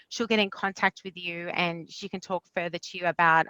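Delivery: normal talking voice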